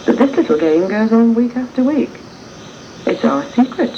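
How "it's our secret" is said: ordinary talk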